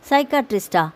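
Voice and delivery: usual speaking voice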